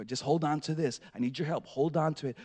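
low, hushed instructions; steady a hurt child softly; quiet retold fatherly coaxing; imitating calm reassurance.

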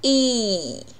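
E held long and smooth; a slow, sustained "ee" for E; long vowel. Shown as a demonstration. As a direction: draw E out evenly as a long vowel. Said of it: "音标长音".

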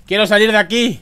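panicked high shout; panicking gamer. Real, not acted. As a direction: scream to get out, terrified. Scared player in ghost game.

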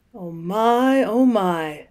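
a touch of breathless wonder; mild gasping. A narrator's reading. Mole gasping at each fresh revelation, lightly breathless. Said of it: "still gasping".

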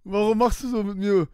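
ordinary delivery

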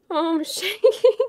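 high, slow, laughing disbelief; shaken laughing. Real, not acted. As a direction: laugh through the adrenaline shakes.

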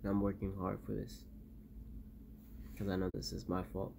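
low, soft and weary; exhausted shaky survivor recording. Acted. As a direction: confess it quietly, worn out. Guilty quiet confession after loss.

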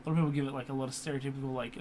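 usual speaking voice

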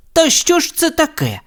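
high, exasperated outburst without shouting; irritated exclamation. A narrator's reading. Steve looking at his botched pumpkins, irritated. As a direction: exclaim it high-pitched, fed up. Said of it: "роздратовано вигукнув Стів".

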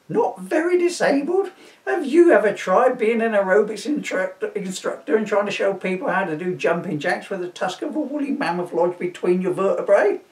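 high, indignant posh man; outraged posh gentleman. Acted. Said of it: "in his posh voice".

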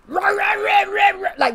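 high, loud growling bark sound; imitated dog bark. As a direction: bark right in their face like a chained dog. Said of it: "the dog is like".

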